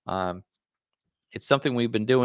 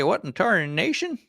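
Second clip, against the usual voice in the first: put-on old prospector; 9 semitones higher than usual. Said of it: "[name] is speaking like an old prospector today".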